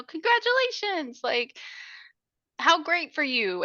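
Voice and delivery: high, fake-cheery congratulations; mock congratulations. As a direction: gush with false delight. [name] fakes praise.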